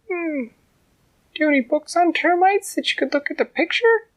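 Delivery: high, loud squeaky little ant; tiny ant voice. A narrator's reading. Ant talking to the troll from inside his ear, high squeak. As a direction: speak up like a tiny determined ant.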